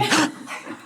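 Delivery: sharp mock gasp; shocked gasp. Mother reading her son's results, shocked gasp.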